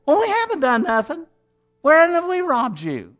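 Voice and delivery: high, whiny protest of innocence; mock whining. Preacher mimics the people denying they robbed God, whiny. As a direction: whine back as if wronged. Mocking Israel's excuses again.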